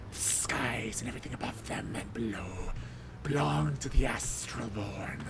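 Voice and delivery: slow, soft hissed threat; old Milda hissing a warning across the sky; hissing whisper. Acted. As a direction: hiss it like an ancient zealot. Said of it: "the angry hissing coming from old Milda".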